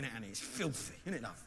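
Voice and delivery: ordinary delivery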